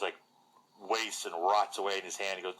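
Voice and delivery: usual speaking voice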